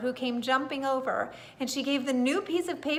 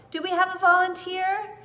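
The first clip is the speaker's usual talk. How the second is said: higher, louder call; 7 semitones higher, 6 dB louder than usual. A narrator's reading. Old lady asking the garden for a volunteer, calling out. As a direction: call out to the crowd. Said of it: "She called out".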